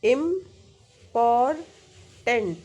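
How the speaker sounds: Important split as इम, पॉर, tent with pauses; three-syllable breakdown. Shown as a demonstration. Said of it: "three syllabic words क्या है".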